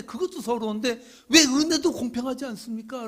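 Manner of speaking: loud, high protest; preaching at full force. Acted. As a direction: cry out to God in complaint.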